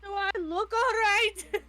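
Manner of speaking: high, loud, slow rhetorical question; incredulous retort. Acted. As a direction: throw the question back, drawn out. Retort after being asked if okay.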